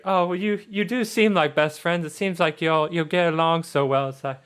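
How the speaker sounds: put-on sweetly sociable girl's voice; friendly Charlotte. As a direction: gush like a daughter about her best friend. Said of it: "so she's like".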